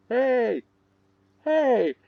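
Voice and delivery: high-pitched imitated call at medium volume; yell getting closer. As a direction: mimic a high yell coming nearer. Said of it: "they're getting closer".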